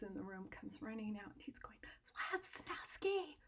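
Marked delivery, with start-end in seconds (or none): 2.3-3.4 whispered name, slow, pitched higher; urgent whisper. Retold or imitated. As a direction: hiss the name across the parking lot. Artist calls him in the parking lot.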